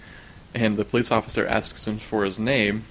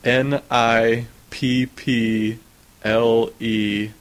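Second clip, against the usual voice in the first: slow, deliberate letter by letter spelling; spelling it out slowly; much slower than usual. Retold or imitated. Retelling the sketch, spelling the name slowly for the cop. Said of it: "And he says".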